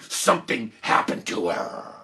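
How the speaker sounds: slow, dropping into a creaky tail; ominous. Retold or imitated. Child warning a friend about the teacher, ominous. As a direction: let it sink slowly into dread.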